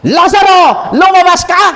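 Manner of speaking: loud high command to Lazarus; shouting. A narrator's reading. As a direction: call him out of the tomb, full voice. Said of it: "sumigaw siya". (malakas na sigaw na utos)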